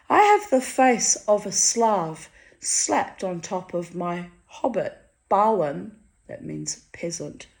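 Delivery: ordinary delivery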